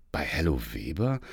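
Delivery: Kirsten doubts the plan, quietly questioning; skeptical. A narrator's reading. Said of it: "fragte sie seltsam skeptisch".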